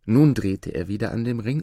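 ordinary delivery

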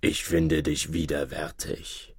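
low, soft and slow imitation; slow disguised voice. A narrator's reading. King posing as the sorceress's lover in the dark, slow disguised voice. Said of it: "mit langsamer Stimme".

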